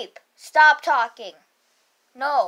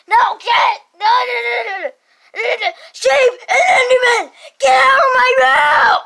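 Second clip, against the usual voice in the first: high loud crying scream; 7 semitones higher, 8 dB louder than usual. Acted. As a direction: scream them out of the room. Kid screaming in scuffle.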